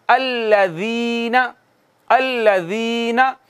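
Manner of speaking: अल्लज़ीना with a soft ज़, drawn out slowly; soft zaal recitation. Shown as a demonstration. Teacher showing the soft letter, reciting अल्लज़ीना slowly. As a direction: say अल्लज़ीना gently, the ज़ soft and long. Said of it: "इसको नरमी से पढ़ेंगे".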